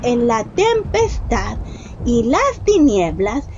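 usual speaking voice

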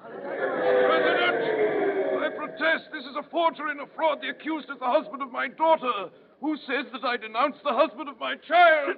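high, desperate pleading protest; a father fighting for his son-in-law; anguished protest. Acted. Father protests forged denunciation.

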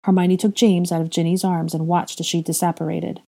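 plain reading voice

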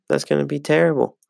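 usual speaking voice

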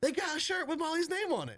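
very high, delighted Southern belle. Retold or imitated. Southern mom telling her husband about the shirt, high imitated belle voice. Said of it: "imagine the voice".

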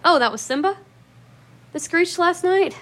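a jolt of surprise, voice jumping up; startled realization. Retold or imitated. Realizing the screech was the cat.

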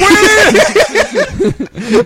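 high giggling fit; cracking up helplessly; burst of laughter. Real, not acted. Breaks into laughter reading.

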